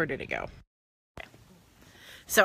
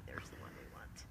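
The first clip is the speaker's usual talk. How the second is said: much softer, whispered aside to herself; 20 dB quieter than usual. Real, not acted. Host whispering to herself while finding the link. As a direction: mutter it privately under her breath.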